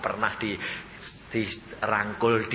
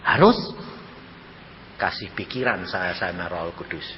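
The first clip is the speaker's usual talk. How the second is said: ordinary delivery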